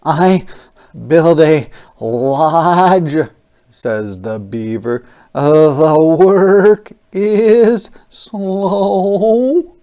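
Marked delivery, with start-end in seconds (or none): 0.0-3.3 louder, slow beaver voice; a proud little beaver; storybook beaver building. Acted. 5.3-9.7 high, loud, very slow beaver voice; tired beaver at work. Acted. Beaver speaks in story.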